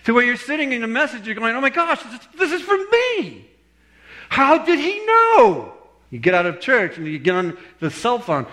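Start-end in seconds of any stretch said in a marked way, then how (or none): 1.6-5.7 amazed realization. Pretend. Churchgoer stunned the sermon hit home, mock astonishment. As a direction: act out a stunned listener's disbelief. Acted listener amazed by the message.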